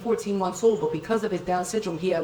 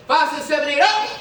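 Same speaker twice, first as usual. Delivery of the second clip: shouted count-in; 7 semitones higher, 5 dB louder than usual. Real, not acted. Dance count-in to a room.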